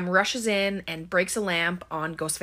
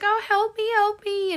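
very high, put-on distressed cry; hammed-up high plea; mock screaming for help; 4 dB louder than usual. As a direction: imitate a victim crying for help. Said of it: "Amber runs out screaming".

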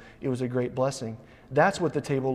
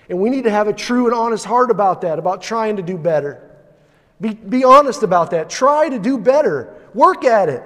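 louder and higher, pressing the charge; urgent exhortation; 6 semitones higher, 8 dB louder than usual. Real, not acted. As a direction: exhort them to try harder.

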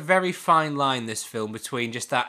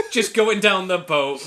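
Goofy yell; 5 semitones higher, 10 dB louder, slower than usual. Retold or imitated. Goofy falling, laughing.

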